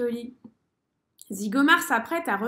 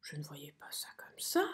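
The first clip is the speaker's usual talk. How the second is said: chuchotement très bas et doux; murmurer, dépité; déçu; 8 semitones lower, 11 dB quieter than usual. A narrator's reading. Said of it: "constate une nouvelle fois". (souris dépitée qui chuchote)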